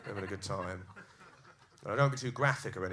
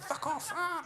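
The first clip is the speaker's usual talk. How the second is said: high-pitched mock yell; imitating girlfriend shrieking at the chasers; high yelp; slower than usual. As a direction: squeal it while kicking out. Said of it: "And she's like".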